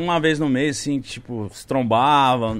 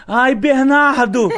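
high, slow, drawn-out call; mock passionate cry, sliding down; imitated woman's cry; 9 semitones higher, 4 dB louder, much slower than usual. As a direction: mimic her crying out the name. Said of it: "ela gritou".

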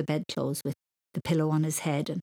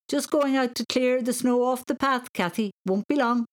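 calling dad; 6 semitones higher, 3 dB louder than usual. A narrator's reading.

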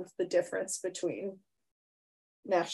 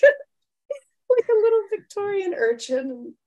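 slow, high, loud urchin whine; Victorian urchin; 11 semitones higher, 6 dB louder, much slower than usual. Shown as a demonstration. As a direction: slip into the urchin voice as you name it. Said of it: "talk like".

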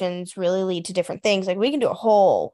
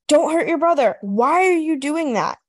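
higher, exasperated mock telling-off; scolding parent; 6 semitones higher than usual. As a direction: act out pleading with a misbehaving child. Parent scolding child, retold.

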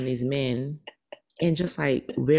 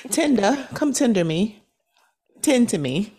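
playful call; 8 semitones higher, 4 dB louder than usual. Pretend. Calling for bartender, joking.

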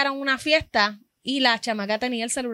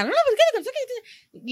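mock shrill protest; 12 semitones higher, slower than usual. Customer protesting at register.

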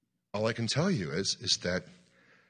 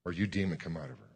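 understated command; 4 semitones lower, 7 dB quieter than usual. Retold or imitated. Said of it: "and I just say".

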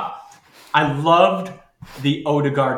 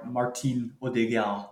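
a French accent on the name; 2 semitones lower, 8 dB quieter than usual. Real, not acted. Answering the question about Martin, French accent. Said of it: "why do you say it in a French accent".

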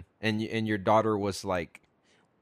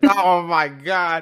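mock shock; 5 semitones higher, 6 dB louder, much slower than usual. Real, not acted. Mock shock at being flirted with.

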